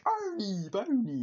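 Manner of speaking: high, drawn-out name call; squeaky imitation of a film character; mimicked cartoon call. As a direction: call both names in a high put-on voice. Acting a character's sung call.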